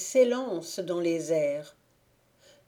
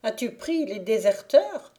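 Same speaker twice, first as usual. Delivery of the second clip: shouting; 4 semitones higher, 7 dB louder than usual. Acted. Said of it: "lui cria la fée".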